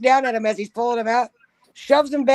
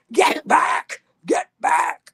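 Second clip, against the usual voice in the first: strained, unvoiced-sounding mock shout; mock barked order; much slower than usual. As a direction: imitate security ordering a child back. Imitates staff pouncing on a child.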